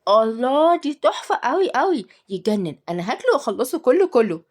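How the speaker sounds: admiring. A narrator's reading. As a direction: rave over food to tempt a child. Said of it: "واتكلمت بإعجاب".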